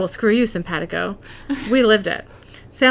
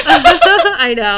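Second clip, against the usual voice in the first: laughing; 9 semitones higher, 8 dB louder than usual. Real, not acted. Shared laugh at book joke.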